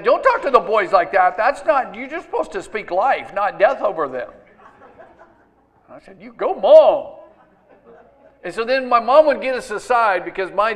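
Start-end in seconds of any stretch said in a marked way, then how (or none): 0.0-4.3 loud, raised-pitch imitation of a scolding mom; mock scolding. Mom correcting dad after his remark, mock scolding. 6.2-7.2 big, high, drawn-out cheer; retold cheer. Son backing his mom against dad, playful loud cheer.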